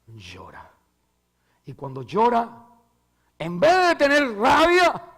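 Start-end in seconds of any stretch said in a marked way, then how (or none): 0.1-0.7 softer, hushed word; hushed weeping word. Real, not acted. Christ weeps, said softly. 2.0-2.7 louder, pressing on llora; rising preaching emphasis. Real, not acted. Repeated word said louder. 3.4-5.0 high, loud fervent preaching; impassioned sermon climax; preaching shout. Real, not acted. Preaching at full force.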